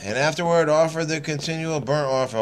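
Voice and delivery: measured, solemn Bible reading; deliberate King James recital; scripture reading. Acted. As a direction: read the verses steadily like a lay preacher. Reads scripture aloud.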